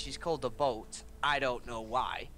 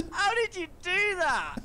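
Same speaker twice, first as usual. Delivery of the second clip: high, loud giggly disbelief; squealed through real laughter; laughing amazement; 5 dB louder than usual. Real, not acted. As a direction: ask while helplessly cracking up. Laughing at duck impression.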